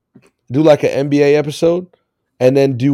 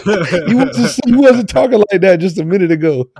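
laughing through the words, pitched up; cracking up while teasing; giggling tease, higher; 9 semitones higher than usual. Real, not acted.

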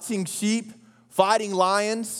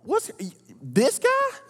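David in disbelief; 6 semitones higher than usual. Retold or imitated. David mocking the scared army, pitched way up in disbelief. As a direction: voice jumping much higher in disbelief. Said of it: "David's like".

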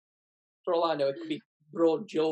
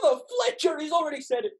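Donkey shouting; 8 semitones higher than usual. Acted. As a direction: yell it in panic. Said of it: "I'll have a bash at donkey".

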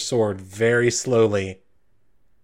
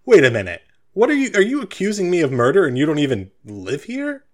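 indignant, voice climbing higher on the question; acted disbelieving offense; confused and insulted; 6 semitones higher, 4 dB louder than usual. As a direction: sputter an affronted accusation, starting loud. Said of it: "He looks a little confused and insulting".

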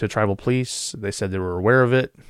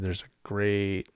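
slow and softer, the father's grave warning; ominous father; 7 dB quieter than usual. Retold or imitated. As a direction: say it slowly, like an old man foretelling doom. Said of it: "He said".

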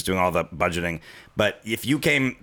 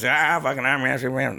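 Larry the Cable Guy impression; 4 semitones higher than usual. Comic telling friends the story, light country impersonation. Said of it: "That's my Larry the Cable Guy impression".

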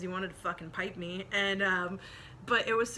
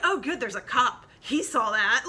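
gleeful quote; 3 semitones higher, 7 dB louder than usual. Retold or imitated. Retold self cheering cop's arrival.

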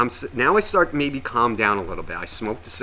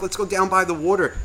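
put-on higher-pitched voice for a little kid; imitated dad coaxing; 7 semitones higher than usual. Dad talking to a little kid, imitated coaxing. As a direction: mimic a parent coaxing a child.

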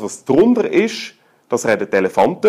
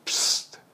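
stimmloses, zischendes Psst, wie um einen Raum zu beruhigen; Psst-Laut; 7 dB quieter than usual. Shown as a demonstration. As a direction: ein langes, gehauchtes Psst ohne Stimme. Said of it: "jede Schwingung ist in einem". (leises Zischen zum Ruhe-Gebieten)

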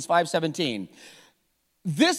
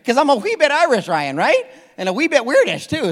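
lilting, higher, louder mock Irish; playful Irish accent; 7 semitones higher, 5 dB louder than usual. Preacher joking about Irish Siri, mock Irish accent. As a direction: put on a playful Irish brogue.

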